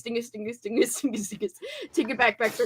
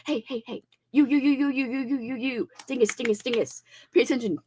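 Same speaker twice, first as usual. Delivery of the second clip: lightly whispered ASMR chant; mock ASMR triggers; faster than usual. Shown as a demonstration. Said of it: "one of the triggers is you say".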